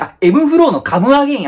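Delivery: the penny drops on エムフローのカムアゲイン; excited realization. Shown as a demonstration. Guessing the muffled word at last, excited realization. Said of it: "エムフローの唐揚げ？".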